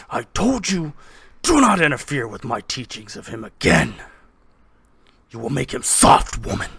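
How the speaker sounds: stern, forceful rebuke, punching key words; loud, domineering husband; arguing father. Acted. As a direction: scold your wife with heavy emphasis. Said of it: "your mother and father arguing".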